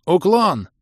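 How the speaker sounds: резкий, высокий, громкий выкрик; крикнуть команду об опасности; вопль. A narrator's reading. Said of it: "завопил я". (завопить во весь голос)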